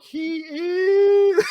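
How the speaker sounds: very high, stretched-out teasing call; imitating a needling high call; sing-song taunt. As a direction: taunt a fuming friend in a silly high voice. Re-enacts taunt from the back door.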